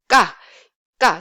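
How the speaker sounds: "ga" after a held build-up of air; short hard "ga" with a tight k, no puff; pause, then pop out "ga"; tense k. Shown as a demonstration. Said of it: "there's a pause where you need time to build the air".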